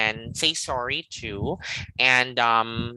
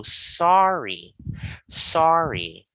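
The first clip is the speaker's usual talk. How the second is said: SORRY with a long, raised first syllable; stress on first syllable; much slower than usual. Shown as a demonstration. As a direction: lean slowly on the "so" in sorry. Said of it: "stress is rising the tone".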